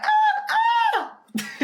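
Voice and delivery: shrill comic scream, way up high; mock high-pitched scream. Vlogger acting out his own scared reaction, mock scream. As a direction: shriek like a panicked guy running around. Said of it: "screaming like that, high-pitched voice, very femininely".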